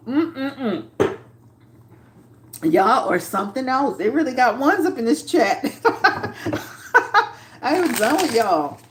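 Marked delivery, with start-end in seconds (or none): 5.7-7.3 high burst of laughter; short cackle, much higher. Real, not acted. Reacting to chat votes.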